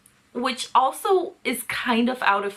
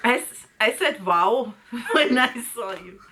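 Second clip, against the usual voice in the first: bad German accent, played for laughs; dramatic reading with a put-on German accent. Co-host as A J explains himself to her, mock German accent. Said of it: "give him a German accent".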